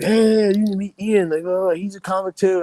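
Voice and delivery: high, loud mock-enthusiastic introduction; hyped introduction. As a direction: imitate pals eagerly talking up their friend. Owners introducing him to big comics.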